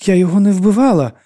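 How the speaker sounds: high, loud desperate denial; loud, high-pitched protest; crying out. A narrator's reading. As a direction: cry out your innocence. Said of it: "скрикнула Лора".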